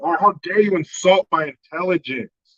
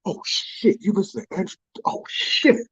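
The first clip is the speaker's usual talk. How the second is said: whispered awe; 3 semitones higher, 5 dB quieter than usual. Retold or imitated. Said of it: "Andrew Dice Clay was like".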